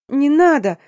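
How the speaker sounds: high, loud wail; wailing plea. A narrator's reading. Girl begs as the hunt is ordered, wailing high. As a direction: wail it out in despair. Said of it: "завыла я".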